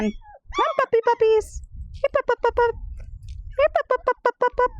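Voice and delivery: high sing-song calling; high-pitched puppy call. Real, not acted. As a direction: coax the puppies along, high. Calling puppies to come inside.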